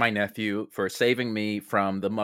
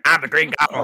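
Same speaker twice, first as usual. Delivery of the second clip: Green Goblin voice; 4 semitones higher, 8 dB louder than usual. Acted. Willem Dafoe as villain.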